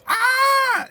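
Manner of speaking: high excited shriek of joy; excited scream. Real, not acted. Telling the friend the news, a high excited shriek. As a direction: squeal with delight at the news.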